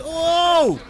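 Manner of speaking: loud, high burst of delight; excited oh. Real, not acted. Gamer reacting to a big hit, with a loud thrilled oh. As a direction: let out a sudden thrilled cry. Big play lands in game.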